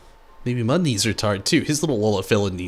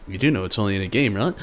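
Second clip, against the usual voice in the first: ordinary talk, nothing marked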